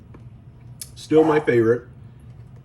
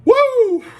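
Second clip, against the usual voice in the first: high burst of laughter; 7 dB louder than usual. Real, not acted. Reacting to the spice.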